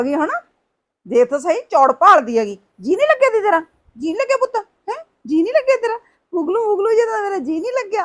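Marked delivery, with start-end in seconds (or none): 2.8-6.0 put-on much higher, cooing baby-talk coax; mock baby talk. Coaxing a sulking child. 6.3-8.0 much higher, fake crying child whine; mock whimper. Mimics child's whiny sulk.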